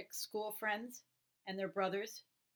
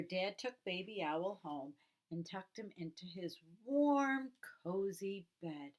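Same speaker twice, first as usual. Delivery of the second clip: usual speaking voice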